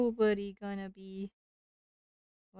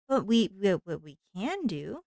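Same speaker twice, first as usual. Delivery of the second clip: normal talking voice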